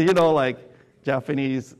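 ordinary delivery

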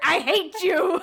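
hammed-up fake fury; mock angry rant. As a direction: finish the parody tantrum, amused. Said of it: "She's literally mad at everything".

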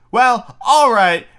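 put-on high, loud and slow boyish swagger; Peter Pan delivery. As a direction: drag it out like a cocky cartoon hero. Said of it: "that's the delivery".

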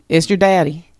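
lightly put-on North Carolina twang; honey-dipped North Carolina accent. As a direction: imitate a sweet North Carolina daddy on the answering machine. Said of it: "I love my dad's accent".

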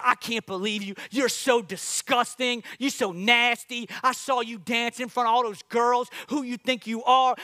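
hammed-up exasperated wife telling him off; wife scolding David after his dance, mock frustration; frustrated wife. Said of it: "His wife's frustrated".

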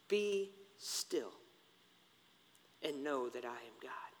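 soft, fading recitation; hushed scripture. Acted. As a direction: let the verse fall to a whisper. Psalm ending softly.

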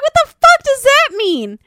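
high, loud offended voice; hammed-up indignant retort; mock offended.

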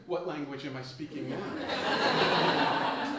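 hammed-up imagined rant with a cricket bat; an imagined Florida man shouting at the tourist, slow mock yell. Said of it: "while shouting".

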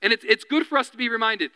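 ordinary delivery

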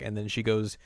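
plain reading voice

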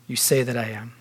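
low and soft, understated; simple quiet reply. Acted. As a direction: answer simply, dropping the voice right down. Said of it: "Jesus simply replies".

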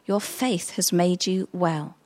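soft, slow, tender words to a daughter; Jesus gently reassuring the healed woman, soft and slow; kind and gentle. Retold or imitated. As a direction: let it land gently, like a blessing. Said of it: "he said to her so kindly".

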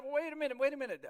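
high, loud interruption; indignant objection. Acted. Objecting to followers of rival leaders, indignant. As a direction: break in with sharp objection. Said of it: "and, and these guys".

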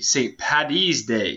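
Saint Patty's Day said slow and deliberate, Patty's stressed; Patty's stressed as the proper form; correct pronunciation. Shown as a demonstration. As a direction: spell out Saint Patty's Day slowly, leaning on Patty's. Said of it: "you said it wrong, Cody".

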